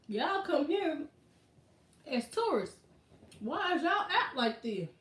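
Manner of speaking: a local asking why tourists wander out; mock local voice.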